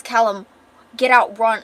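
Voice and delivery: loud commanding shout; urgent warning. Acted. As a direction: order your son to flee. Acted dad warning his son.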